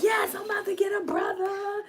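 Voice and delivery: put-on high, shouted little-boy joy; excited boy. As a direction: yell it like a thrilled little brother. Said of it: "Tayden was like".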